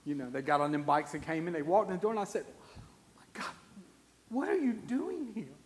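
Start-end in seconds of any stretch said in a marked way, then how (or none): 3.2-3.5 stunned whisper. Retold or imitated. Stunned at bikers arriving. 4.3-5.6 amazed question. Retold or imitated. Stunned at bikers arriving.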